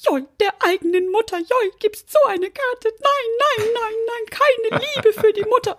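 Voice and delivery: put-on high, wailing old granny, whining; old grandma voice. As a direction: wail like a sore-losing grandma. Grandma quoted again at table.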